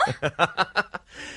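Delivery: short airy chuckle; a brushing-off laugh; light laugh. Acted. Amused by her question.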